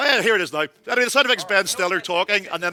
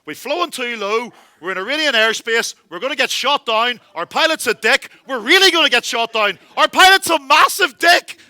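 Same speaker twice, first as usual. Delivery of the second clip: rising panic; 4 semitones higher, 6 dB louder than usual. Retold or imitated. Passenger fearing the jets will shoot them, panicking. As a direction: panic building from worried to a full shout.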